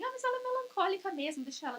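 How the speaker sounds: husband shrugging off his wife's pain, lightly mocked; dismissive husband. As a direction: say it like a husband who can't be bothered. Husband voiced dismissing her.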